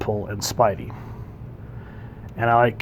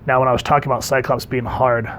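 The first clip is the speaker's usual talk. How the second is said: ordinary delivery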